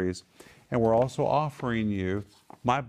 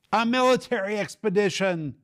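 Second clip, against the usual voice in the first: high, loud exclaimed answer to his own question; emphatic answer; 10 semitones higher, 4 dB louder than usual. Real, not acted. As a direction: blurt out the answer in mock exasperation. Teacher answers his own quiz.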